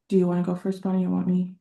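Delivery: usual speaking voice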